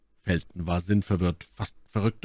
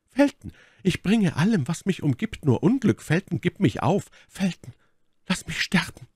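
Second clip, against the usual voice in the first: high, desperate lady's plea; Milady, verzweifelt flehend; 11 semitones higher than usual. Acted. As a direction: beg him to give you up. Milady pleads with Felton. (Mylady, hoch und verzweifelt flehend)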